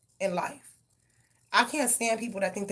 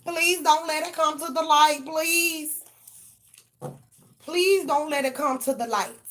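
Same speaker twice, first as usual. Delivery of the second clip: higher, louder, begging not to be exposed; mock pleading; 7 semitones higher, 6 dB louder than usual. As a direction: plead like someone terrified of being found out. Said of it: "Somebody's like".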